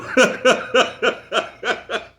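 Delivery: real cackle at his own joke; helpless high laugh; high loud cackle. Real, not acted.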